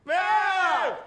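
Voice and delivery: high loud shout of alarm; huge yell. Acted. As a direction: yell out a warning. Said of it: "there was a huge yell".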